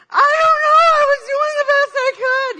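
high, loud mock wail; hammed-up protest. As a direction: whine back at the coach in self-defense. Swimmer answers the scolding coach.

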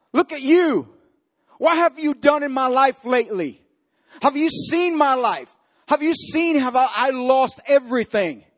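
indignant complaint. Acted. Preacher voicing the leper refusing Jesus, mildly indignant. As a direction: throw it at Jesus like a grudge.

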